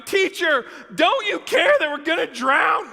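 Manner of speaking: high, loud cry for rescue; panicked shouting. Acted. Disciples wake Jesus in the storm, shouting in panic. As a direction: shout it as if the boat is sinking. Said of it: "they woke him up shouting".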